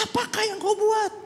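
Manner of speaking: a pot sneering at its maker; clay talking back. Acted. Clay questioning the potter, high mocking voice. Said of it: "berkata kepada pembentuknya".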